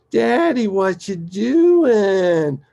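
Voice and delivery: high, loud, drawn-out child imitation; squeaky little-girl voice. Little girl bounding in to question Daddy, squeaky. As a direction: mimic a small daughter calling out. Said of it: "with this little squeaky voice of hers".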